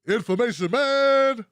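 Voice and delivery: loud, punchy announcer call of the name; hype shout-out. Acted. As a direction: belt it out like a hype man. Hyped shout-out to a channel.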